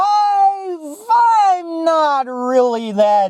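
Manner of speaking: high, slow, hammed-up sob; mock crying. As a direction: whine it like fake crying. Mock sobbing confession.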